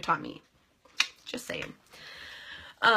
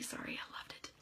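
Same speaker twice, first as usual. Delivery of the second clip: soft, hushed apology; whispered aside; 18 dB quieter than usual. Real, not acted. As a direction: whisper it under your breath.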